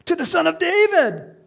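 high, loud jubilant cheer; children shouting. Acted. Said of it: "the children shouting in the temple courts".

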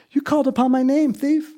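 Jesus welcoming the thief; warm call. Acted. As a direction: greet the thief gladly. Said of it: "fulfilled that promise".

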